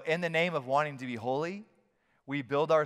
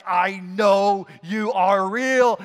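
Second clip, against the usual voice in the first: earnest spirit voice; 4 semitones higher, 10 dB louder, much slower than usual. Pretend. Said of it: "your spirit's like".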